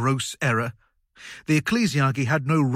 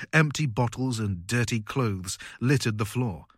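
usual speaking voice

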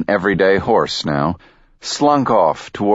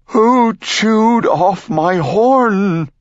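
high, slow anguished wail; 11 semitones higher, much slower than usual. Acted. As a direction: wail it like a heartbroken unicorn. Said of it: "he wailed".

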